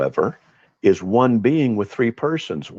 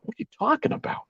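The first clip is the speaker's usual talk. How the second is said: room's confused whisper; 13 semitones higher, 6 dB quieter, faster than usual. Retold or imitated. The room reacts, retold.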